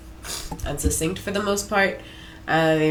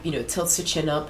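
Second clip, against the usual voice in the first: usual speaking voice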